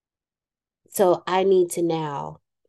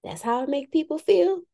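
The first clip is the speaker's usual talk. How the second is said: mock realization; 9 semitones higher than usual. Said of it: "And you be like".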